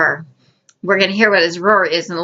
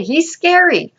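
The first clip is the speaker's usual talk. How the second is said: pitched high, hammed-up spooky; spooky mock scary; 8 semitones higher than usual. Preacher to congregation about the giant, mock spooky high. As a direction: say it like telling a ghost story.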